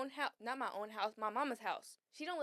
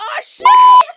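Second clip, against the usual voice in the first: high, loud "oh shit"; shocked yell; 25 dB louder than usual. Retold or imitated. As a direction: cry out in sudden alarm. Realizing during the fight.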